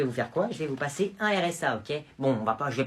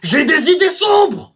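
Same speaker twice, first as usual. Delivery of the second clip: high, loud and slow mock growl; mock menace; 12 semitones higher, 11 dB louder, slower than usual. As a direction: ham up the dark werewolf line. Boy says he's a werewolf.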